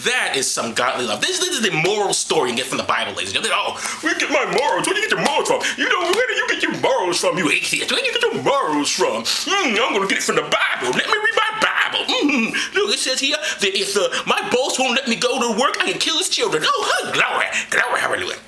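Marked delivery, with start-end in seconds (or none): 3.6-9.2 mocking believer. Comic atheist mocking a smug churchgoer, high nasal sneer. 9.2-16.6 high, giggling parody voice; mock believer. Comic voicing a believer reading his Bible, high and giggly. 16.7-18.4 high mock-pious praise; mock hallelujah. Comic mocking church praise, a high hammed-up hallelujah.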